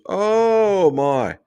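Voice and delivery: a high, loud "Oh" that falls away on "my"; surprised oh my. Retold or imitated. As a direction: act out the client's startled gasp of dismay. Client reacts to surgery question.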